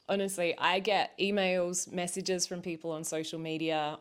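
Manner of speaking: ordinary delivery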